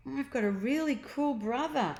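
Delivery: a hint of a proud big sister. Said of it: "remarked Gemma".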